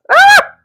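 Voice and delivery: high, loud embarrassed shriek; sudden squeal of mock horror; shocked shriek. Real, not acted. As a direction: yelp at what you just said. Shocked at her own joke.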